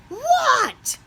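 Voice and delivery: high, loud startled what. Acted. Froggy startled by his name, high loud what.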